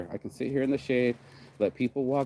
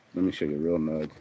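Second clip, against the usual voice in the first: normal talking voice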